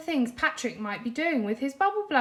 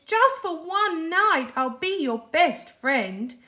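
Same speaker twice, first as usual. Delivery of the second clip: slow, higher eager plea; pleading; 7 semitones higher, 6 dB louder, slower than usual. A narrator's reading. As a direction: drag it out to win him over. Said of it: "he pleaded".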